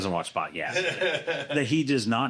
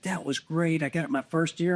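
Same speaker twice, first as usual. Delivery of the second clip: put-on higher voice for an excited young hunter; imitating the kid; 8 semitones higher than usual. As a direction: play the thrilled kid, pitched up. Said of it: "he's like".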